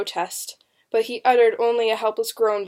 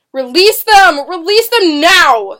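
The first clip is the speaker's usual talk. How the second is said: high, loud, urgent demand; father's cry; 8 semitones higher, 14 dB louder, slower than usual. A narrator's reading. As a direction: cry out for their release at full voice. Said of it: "heard her father cry".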